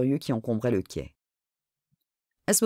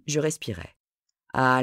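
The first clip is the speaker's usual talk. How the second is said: ordinary delivery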